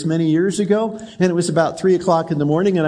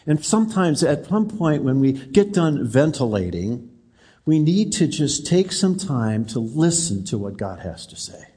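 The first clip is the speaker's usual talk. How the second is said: usual speaking voice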